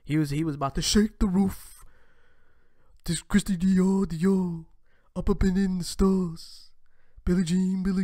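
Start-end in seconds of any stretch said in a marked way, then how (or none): 3.1-8.0 loud, higher rapping [name]; [name] impression. Rapping his lines to the viewers, a raised [name] imitation. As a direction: belt the [name] ad-libs. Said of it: "I can't even do his voice".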